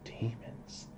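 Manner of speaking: soft, whispered question; hushed disbelief. Acted. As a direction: ask it under your breath. Character taken aback by demon news.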